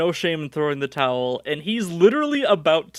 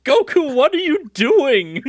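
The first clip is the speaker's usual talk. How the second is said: loud, high, drawn-out accusing question; accusatory; 8 semitones higher, 5 dB louder than usual. Acted. Jackie still electrocuting Goku as he transforms, accusatory. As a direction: demand it in mock outrage. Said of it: "It's very accusatory".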